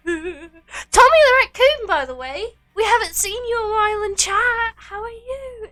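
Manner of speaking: excited. Real, not acted. Excited shout-out to a viewer.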